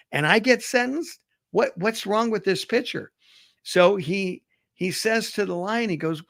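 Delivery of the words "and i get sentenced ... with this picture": mildly acted, higher-pitched disbelief; aggrieved mock questioning; indignant protest. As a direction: protest an unfair verdict, pitch climbing. Tiger protests his unfair sentence.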